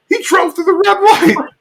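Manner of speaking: gasping, very high disbelief; mock outrage. Hank watching Alabaster run the light, high shock. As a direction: imitate scandalized shock, high pitched. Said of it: "he thinks, like".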